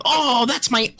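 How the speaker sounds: high mock wail of pain; Gilbert impression. Co-host shows friend getting his arm snapped, mocking impression. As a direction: cry out high, drawing out the last word. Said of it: "'Cause that's what you sound like".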